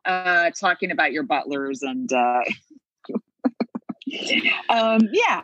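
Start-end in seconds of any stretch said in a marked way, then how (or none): 3.4-4.6 whispered laugh. Real, not acted.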